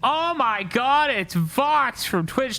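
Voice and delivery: high, hammed-up excited announcement; hyped raid shout-out. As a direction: greet a raider with showy, high-pitched excitement. Hyped greeting for a raiding streamer.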